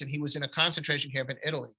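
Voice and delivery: ordinary delivery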